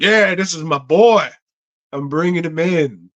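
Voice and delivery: high, loud imitated bragging; mock enthusiastic vouching. Imitating a boss who hires his pal, hearty vouching. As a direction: vouch for a buddy with exaggerated gusto.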